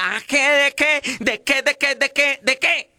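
high, fast bickering wife; nagging wife. Retold or imitated. Wife bickering at the drunk husband, high and fast. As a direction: mimic a shrill nagging wife.

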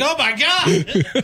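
high, drawn-out mock thrill; mock swooning. Meme woman as the other guy comes in, swooning. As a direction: squeal it like a smitten fangirl, laughing.

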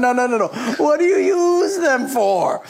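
a hint of divine exasperation; mock pleading Lord. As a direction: beg them to answer. The Lord pressing the question.

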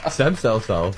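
laughing, drawn-out echo of a teasing nickname; tickled, laughing as you say it; amused. Real, not acted. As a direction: repeat it slowly through a laugh.

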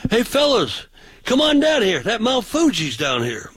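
high, carrying call down the street; mock yell. Preacher hails the fellas down the sidewalk, high mock yell. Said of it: "So I yelled down the sidewalk".

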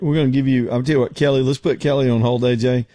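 normal talking voice

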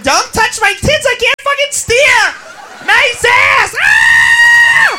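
high squealing yell, rising into a scream; wild party girl. Acted. Girl driver to friends packed in car, high yelling. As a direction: shriek over the packed car.

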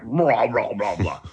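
garbled, rumbling mock stomach growl; stomach grumble imitation. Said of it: "my stomach just grumbled".

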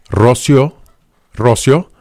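"Rocío" as two syllables, RO-syo; name read without accent. Shown as a demonstration. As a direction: stress the first syllable of "Rocío", merging the -ío. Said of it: "La forma en que lee su nombre".

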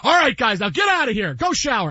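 imitated coach dismissing the team; John Goodman. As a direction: bark orders like a football coach. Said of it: "and John Goodman goes".

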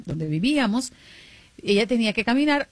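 usual speaking voice

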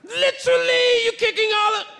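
bad British accent. Comedian repeating the guard's complaint, bad British accent. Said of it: "I don't do a good British accent".

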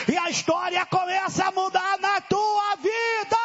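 preacher lifting the crowd; rising preaching. Acted. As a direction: climb into the climax of the sermon. Preacher climbs in pitch.

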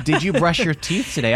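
hint of a prying wife. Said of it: "when my wife asks".

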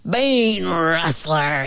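very slow, growled accusation; drawn-out rough bear growl; roaring riled bear. A narrator's reading. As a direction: roar it slowly like a riled grizzly. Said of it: "he roared".

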